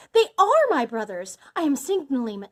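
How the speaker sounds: elated, forceful outburst; excited exclaiming. A narrator's reading. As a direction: announce the rescue urgently. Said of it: "she exclaimed a moment later".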